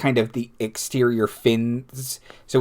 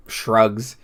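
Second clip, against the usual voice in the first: normal talking voice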